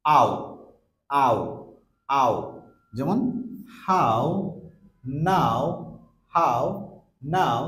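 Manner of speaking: আও, হাউ, নাও said a sliding into o; diphthong demo. Shown as a demonstration. Teacher drilling আও and example words. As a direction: glide আও from open a to round o. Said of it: "the last one is".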